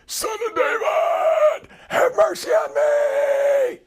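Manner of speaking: very high, slow, cracking scream; croaking raven cry. Acted. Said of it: "voice sounded like a croaking raven".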